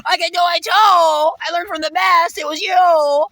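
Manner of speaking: loud high retold shout at the sociopath; yelling. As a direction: shout it back, defiant and loud. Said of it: "The only time I wasn't calm".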